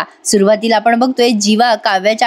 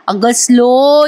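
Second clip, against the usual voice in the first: mocking slow; much slower than usual. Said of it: "जीवा चिडतो आणि म्हणतो".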